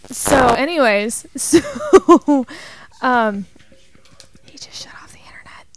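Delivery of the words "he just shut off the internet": whisper. Real, not acted. Whispers mid story.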